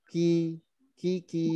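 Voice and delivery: Ki said thin with the lips drawn back. Shown as a demonstration. Teacher models kaf with kasrah, thin. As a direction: draw it thin and short.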